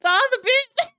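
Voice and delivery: high, loud squeal through real laughter; helpless laughter. Real, not acted. Host reacting to a played clip, helpless laughing squeal. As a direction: squeal the words out while cracking up.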